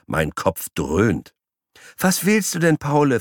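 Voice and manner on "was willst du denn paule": high, asking sweetly; high coaxing woman. Acted. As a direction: coax a pet, lightly voiced. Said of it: "fragt die Petersilie".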